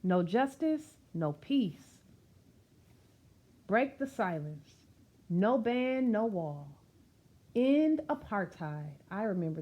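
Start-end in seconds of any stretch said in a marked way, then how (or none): none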